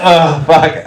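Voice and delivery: put-on drunk bragging quote. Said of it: "Is that what someone said?".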